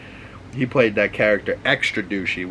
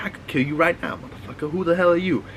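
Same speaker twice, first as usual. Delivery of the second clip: menacing threat; 6 semitones higher, faster than usual. Retold or imitated. Rock threatens man with knife.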